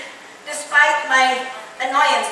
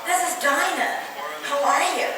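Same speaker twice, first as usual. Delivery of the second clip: unhurried, melodious imitated hello; beautiful voice. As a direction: imitate a lovely-voiced woman on the phone. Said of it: "really beautiful voice".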